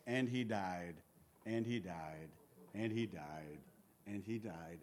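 low, soft, fading repetition; solemn refrain. Acted. Preacher reciting the deaths in Genesis, solemn and hushed.

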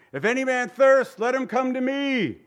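high, loud cry across the crowd; shouted proclamation. Acted. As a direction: call it out to the whole temple court. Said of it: "shouted out loud".

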